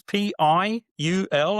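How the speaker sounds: slowly puzzled spelling; mock confused reading back; slow spelled-back letters. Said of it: "and she goes".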